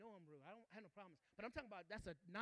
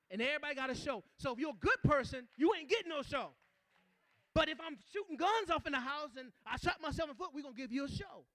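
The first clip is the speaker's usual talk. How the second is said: louder, fast preacher's call to the saints; fired-up preaching; 5 semitones higher, 12 dB louder than usual. Acted. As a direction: preach it hard to the congregation. Preaching to the congregation.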